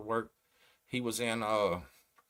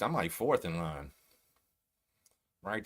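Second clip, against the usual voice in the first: usual speaking voice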